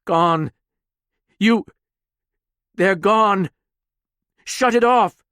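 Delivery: high, loud, near-tears pleading; tearful panic. Acted. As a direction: break down as you beg. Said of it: "eyes going red with forming tears".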